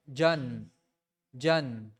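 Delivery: jan said low, twice, for repeating; model jan. Shown as a demonstration. Teacher modelling jan for students to repeat. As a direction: say jan low and clear for the class to echo. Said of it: "say it".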